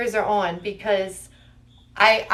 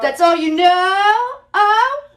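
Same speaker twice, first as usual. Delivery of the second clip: higher, louder mock disbelief; mock surprise; 8 semitones higher, 4 dB louder than usual. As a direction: tease with fake shock. Teasing the voice.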